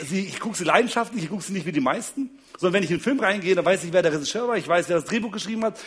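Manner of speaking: ordinary delivery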